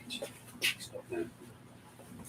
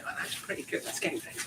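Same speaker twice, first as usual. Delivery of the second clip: usual speaking voice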